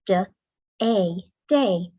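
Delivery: "Da" and "a" apart, then "day" blended; pinching the sounds. Shown as a demonstration. Teacher showing children how to blend sounds. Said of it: "Pinch the sounds".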